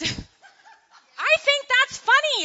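high, loud, helpless giggling; laughing. Real, not acted. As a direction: really crack up while trying to speak. Cracks up at the chapter title.

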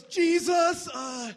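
very loud, high desperate call for help; imitating Justin yelling. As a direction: shout across the field for Josh to pray. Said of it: "do the Justin imitation".

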